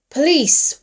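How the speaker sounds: loud, high policeman's shout. A narrator's reading. Policeman challenging attackers in the dark, shouted. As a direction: shout it out in full command. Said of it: "Wyatt shouted".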